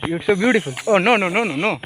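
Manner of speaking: ordinary talk, nothing marked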